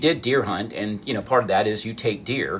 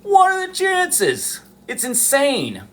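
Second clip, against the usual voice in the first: very high, drawn-out exclamation of disbelief; high-pitched wonder; astonished; slower than usual. Real, not acted. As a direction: squeal it slowly, amazed at the luck. Marvels again at lucky find.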